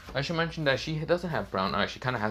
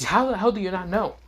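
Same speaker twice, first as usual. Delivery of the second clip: high, louder disbelieving demand; indignant outburst; 9 semitones higher, 5 dB louder than usual. Acted. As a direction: throw it at him in outrage. Izzy furious at Aizen.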